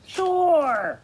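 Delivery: high, eager puppet character. Acted. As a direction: pitch it up like a pushy puppet. Puppet asks to come over.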